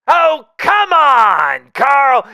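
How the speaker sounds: high, loud, slow whining plea; hammed-up indignant whine; whiny protest. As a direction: drag it out in a loud complaint. Protests being left out.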